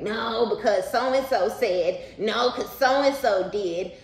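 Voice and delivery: high, loud, slow mocking sing-song; mock their endless old grudges in a sing-song; mocking whine. Reader mocking a person stuck on old grievances, in a high sing-song. Mocking someone who won't let go.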